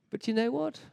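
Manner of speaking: usual speaking voice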